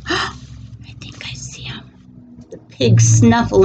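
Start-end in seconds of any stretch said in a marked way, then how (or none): 0.1-1.8 hushed, breathy secret whisper. Acted. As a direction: whisper it like you spotted him. Animal spots the farmer.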